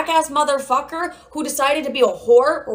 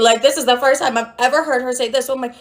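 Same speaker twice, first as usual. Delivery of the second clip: ordinary delivery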